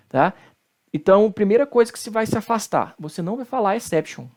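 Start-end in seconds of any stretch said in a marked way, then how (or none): 3.8-4.3 exception ending in an English -shun; wrong English-style pronunciation. Shown as a demonstration. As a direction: mispronounce exception as an English word. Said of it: "não é inglês".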